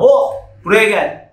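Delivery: a loud, slow call: Hop, buraya gel. Shown as a demonstration. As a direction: stretch out and call Hop across the room. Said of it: "teacher demos her favourite call". (seslenerek, yavaş ve yüksek sesle)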